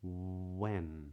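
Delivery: "When" with a long oo-like W at the start; rounded W. Shown as a demonstration. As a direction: linger on rounded lips, then open into "When". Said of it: "try that in these words".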